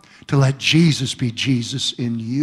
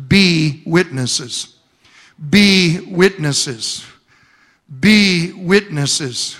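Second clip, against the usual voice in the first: slow emphatic repetition; 3 dB louder, much slower than usual. Real, not acted. Repeating key phrase.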